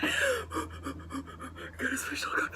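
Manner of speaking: slow, unvoiced crying whisper; real happy tears; tearful whisper. Real, not acted. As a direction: choke it out in tears of joy. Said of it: "I'm gonna cry".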